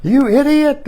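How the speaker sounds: hammed-up frustrated yell; mock yell. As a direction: snap at tech support in mock rage. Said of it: "starting to yell at the tech support".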